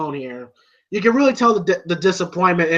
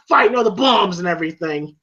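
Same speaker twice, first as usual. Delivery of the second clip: usual speaking voice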